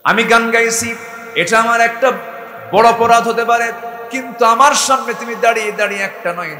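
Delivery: loud, high scolding retort; woman scolding the stranger, loud and high; defiant woman's retort. Acted. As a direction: throw the accusation back at him. Woman voiced rebuking the caliph.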